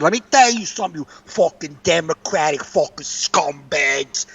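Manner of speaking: imitating a ranting Long Island woman; New York accent. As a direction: do a mock New York tirade. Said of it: "She's got that fucking New York accent".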